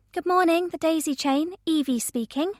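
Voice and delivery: chirpy receptionist picking up; cheerful phone greeting. A narrator's reading. Shop assistant answers the phone.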